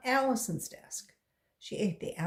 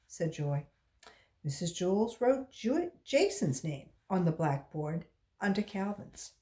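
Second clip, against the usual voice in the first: usual speaking voice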